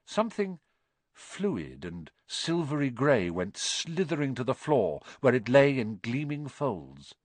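ordinary delivery

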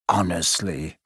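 low disbelieving scoff; scornful aside. A narrator's reading. Ron dismissing Hermione's panic, scornful. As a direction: drop low in exasperation.

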